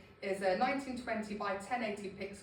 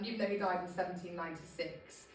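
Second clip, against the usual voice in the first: ordinary talk, nothing marked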